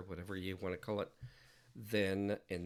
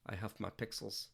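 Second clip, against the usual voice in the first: ordinary talk, nothing marked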